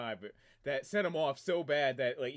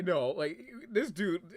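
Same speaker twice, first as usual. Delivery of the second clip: ordinary talk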